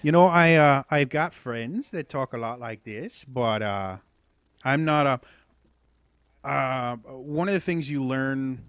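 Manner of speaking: loud Fargo-style accent; broad Minnesota accent, projected. Shown as a demonstration. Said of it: "the Fargo kind of accent".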